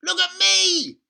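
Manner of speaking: high, loud demanding shout; grandma shouting down from the rooftop. Acted. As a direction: yell for attention from the roof. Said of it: "Grandma shouted from the rooftop".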